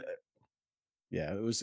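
usual speaking voice

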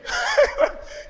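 high-pitched incredulous laugh; squealing laugh at the very idea. Retold or imitated. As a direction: break into a high, scoffing laugh. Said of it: "And I laugh".

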